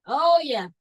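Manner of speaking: usual speaking voice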